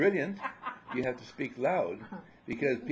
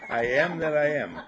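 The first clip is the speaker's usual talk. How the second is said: laughing demo; 3 semitones higher, 8 dB louder than usual. Shown as a demonstration. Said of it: "Try it again".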